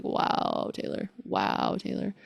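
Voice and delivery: soft, unvoiced rueful whisper; self-mocking whisper. Real, not acted. Looking back at her younger self, rueful whisper. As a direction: scold yourself quietly with a wry shake of the head.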